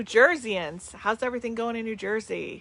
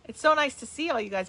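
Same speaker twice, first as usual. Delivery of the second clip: normal talking voice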